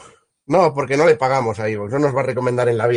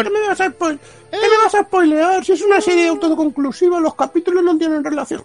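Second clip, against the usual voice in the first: squeaky high indignant voice; shrill mock outrage. Mock outrage at spoiler warning.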